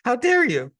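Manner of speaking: very high, loud mock outrage; wronged little child. Struck child to the Zen master, mock outraged. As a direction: squeal it in hammed-up indignation. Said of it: "You're the little child".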